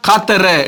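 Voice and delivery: கத்தர said with a hard k in place of h; hard-k slip on கத்தர; common mispronunciation. Retold or imitated. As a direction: open கத்தர on k, as learners wrongly do. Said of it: "என்று உச்சரிக்கிறாங்க".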